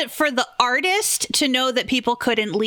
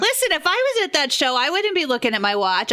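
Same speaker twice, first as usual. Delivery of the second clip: normal talking voice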